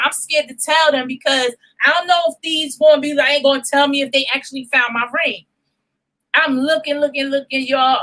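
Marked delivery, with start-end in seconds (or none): none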